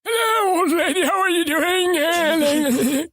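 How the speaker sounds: put-on very high, drawn-out old man voice. Shown as a demonstration. Testing if friends laugh, high old man voice. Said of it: "we've heard your old man voice".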